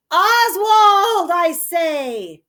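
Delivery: calling out. Acted. Goneril summoning Oswald, calling out loudly. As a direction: shout for the servant across the hall.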